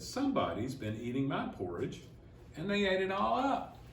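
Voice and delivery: put-on little Baby Bear, swooping up high at the end; plaintive small cub, climbing in pitch; wee small voice. A narrator's reading. Baby Bear finds his bowl empty, wee small voice. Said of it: "in his wee, small voice".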